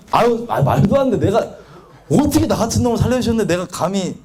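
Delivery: ordinary talk, nothing marked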